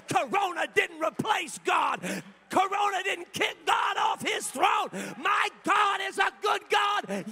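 loud, high, building preacher's cry; full-force preaching. Real, not acted. Pastor to the congregation, preaching at full force.

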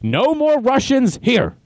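high, slow mock guard voice; mock soldier. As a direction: play a soldier lightly. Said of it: "they're like".

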